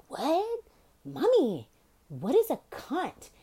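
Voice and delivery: put-on high, innocent little-kid asking; child voice. Asking mom in the car, innocent high kid voice. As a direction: ask it like a curious twelve-year-old.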